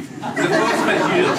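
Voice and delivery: slow, loud, high flourish; confident, stretched-out French phrase; grand announcement. Real, not acted. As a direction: proclaim "force majeure" with drawn-out pomp. Proclaims the corrected French phrase.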